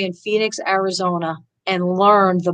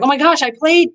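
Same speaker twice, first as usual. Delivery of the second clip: excited report; 6 semitones higher, 3 dB louder than usual. Retold or imitated. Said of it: "people are like".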